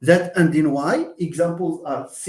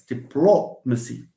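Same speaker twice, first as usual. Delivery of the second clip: low-pitched diplomacy, beat on "plo"; stress on PLO; 4 semitones lower than usual. Shown as a demonstration. Teacher confirms the stressed syllable, stress on PLO. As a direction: land on "plo" in diplomacy, then drop. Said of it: "It's this plo that we stress".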